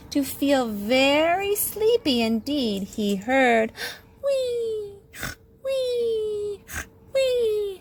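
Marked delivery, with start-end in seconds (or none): 4.2-7.8 high squeaky "wee"; high squeak. A narrator's reading. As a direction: squeak up high like a tiny creature. Mysterious high call.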